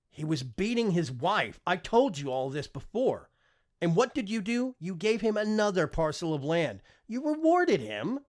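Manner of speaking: rising, loud outrage; angry accusation. Acted. Son accuses father.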